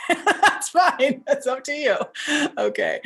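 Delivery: high, loud laughing reassurance. Real, not acted. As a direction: laugh through it as you let them off the hook.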